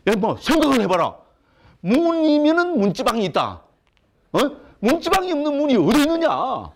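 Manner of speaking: high-pitched, indignant insistence; argumentative Gyeongsang man. Acted. Lecturer mimics a Gyeongsang man arguing his point, high and indignant. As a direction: argue it as if utterly obvious. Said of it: "그런 경상도 사람이 어디 있습니까".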